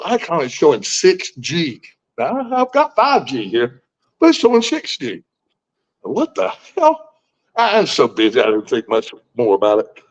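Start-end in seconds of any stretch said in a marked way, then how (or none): 6.0-7.1 high, drawn-out disbelief; baffled what the hell. Retold or imitated. Caller retelling spotting six G on his phone, baffled. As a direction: let it rise slowly in confusion. Retold puzzlement at phone icon.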